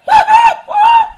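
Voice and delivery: high shrieking laugh; mocking laugh. Jeering at the other side in the quarrel, high laugh.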